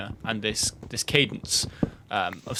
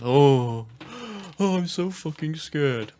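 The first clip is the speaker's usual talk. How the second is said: mock terrified gasp, higher and slow; hammed-up fright; 3 semitones higher, 4 dB louder, slower than usual. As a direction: play at being terrified of the spawn. Hammed-up fright after spawning.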